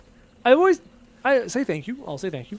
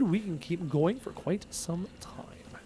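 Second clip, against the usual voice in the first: whisper; 11 dB quieter, slower than usual. Real, not acted. Quiet aside during stream.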